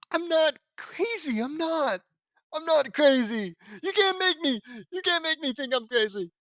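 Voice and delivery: high, loud insistence, half-hearted madness; hammed-up madman protesting; mock unhinged yell. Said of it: "they're gonna write a chapter about me".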